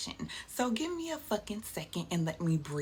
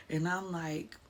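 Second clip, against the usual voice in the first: usual speaking voice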